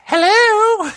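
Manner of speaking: high falsetto mom voice. Retold or imitated. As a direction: answer the phone as mom. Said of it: "I answered as my mom".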